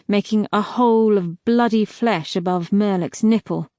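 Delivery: ordinary delivery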